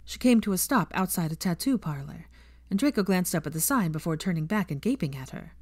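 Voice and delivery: usual speaking voice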